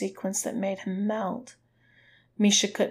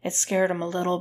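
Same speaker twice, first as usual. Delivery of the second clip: plain reading voice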